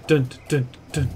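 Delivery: ordinary delivery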